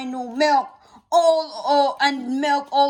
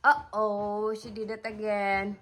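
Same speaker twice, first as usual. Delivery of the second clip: softer, lower, playful hush; 4 semitones lower, 7 dB quieter than usual. Acted. Mother hushing to her toddler mid-rhyme. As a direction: drop the voice for the joke.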